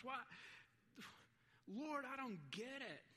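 high-pitched, indignant pleading; aggrieved protest. Acted. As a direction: plead your unfair case before God. Acts out protesting prayer to God.

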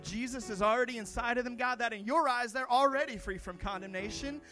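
building prayer. Acted. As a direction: pastor prays freedom over the congregation, rising fervor. Pastor prays over the congregation.

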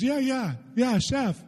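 high, excited imitation of a girl; mimicked girl's delighted yeses; retold eager agreement.

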